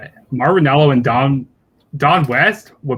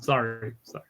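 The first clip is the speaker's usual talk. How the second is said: ordinary talk, nothing marked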